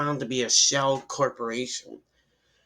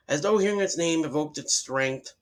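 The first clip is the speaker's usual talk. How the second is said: plain reading voice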